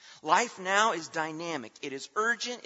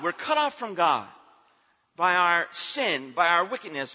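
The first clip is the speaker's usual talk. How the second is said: ordinary talk, nothing marked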